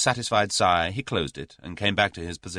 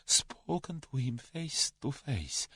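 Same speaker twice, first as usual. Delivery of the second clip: high, very slow Russian confession; 8 semitones higher, 7 dB quieter, slower than usual. A narrator's reading. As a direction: weigh each word fearfully. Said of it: "said the Russian slowly".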